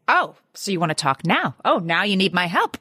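snide told-you-so, hammed-up; sarcastic gloating. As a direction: rub it in with mock triumph. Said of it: "opportunity to say".

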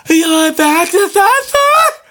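squeaky high cashier parody, soaring on the last word; mock the question in a piercingly high voice. Cashier asking a shopper with a full cart, mocking squeal. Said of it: "and you go".

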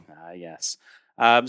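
plain reading voice

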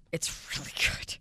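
breathy whisper repeat; soft whispered aside. Shown as a demonstration. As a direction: whisper it like a secret.

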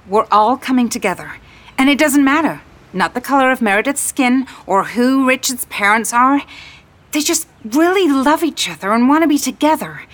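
high, loud, fervent speech; starry-eyed outburst at full enthusiasm; impassioned gushing. A narrator's reading. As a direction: proclaim it, carried away by romance. Impassioned speech about love to stranger.

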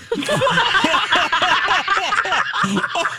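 high, drawn-out laughter; high peal of real laughter; long laugh. Real, not acted. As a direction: crack up at the punchline. Laughing at "not my friend.

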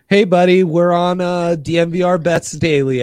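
hey buddy, called out loud; loud greeting. Real, not acted. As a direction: greet him loudly on the call. Calling out on phone.

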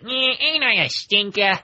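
lightly voiced Bugs Bunny quip; a hint of Bugs Bunny. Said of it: "he says something that he always says".

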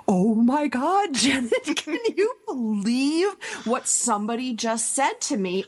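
disbelieving, spilling it to a friend; incredulous venting to a friend. Retold or imitated. Calling grief buddy Janet about a rude remark, high and incredulous. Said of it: "I would call her up and I would say".

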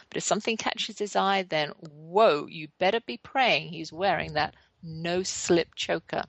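usual speaking voice

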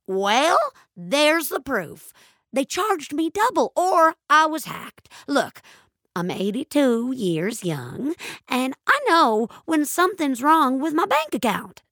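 put-on indignant old lady. A narrator's reading. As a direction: protest like a stubborn old lady.

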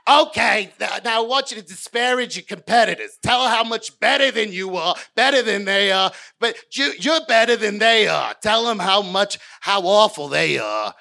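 bossy host. Retold or imitated. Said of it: "manipulate me like a fucking doll".

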